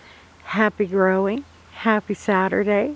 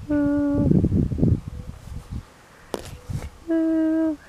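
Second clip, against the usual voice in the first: high, delighted ooh; 9 semitones higher than usual. Real, not acted. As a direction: coo over the fruit with delight. Admiring peaches.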